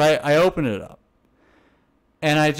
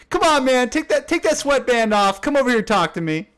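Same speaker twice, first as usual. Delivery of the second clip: higher, hammed-up flirty plea; mock come-on; 9 semitones higher than usual. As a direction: coax her over, half-joking. Voicing a flirting player.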